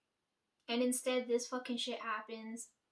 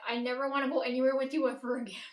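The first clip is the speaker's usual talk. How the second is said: louder, hot and final; fed-up vow; 5 dB louder, faster than usual. Retold or imitated. Swearing off the dream companion, a loud fed-up declaration. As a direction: snap it out like you mean it.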